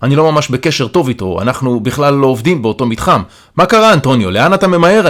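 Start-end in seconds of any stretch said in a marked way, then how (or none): 3.5-5.1 raised, higher-pitched call. A narrator's reading. Calling to Antonio as he hurries past, calling out. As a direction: call after someone hurrying off. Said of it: "אני קורא אליו".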